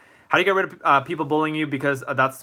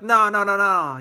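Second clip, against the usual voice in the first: higher, louder mock refusal; dismissive refusal; 8 semitones higher, 4 dB louder, slower than usual. Principal refusing to bring the speaker back, high and dismissive. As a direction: wave the idea away in a pitched-up voice.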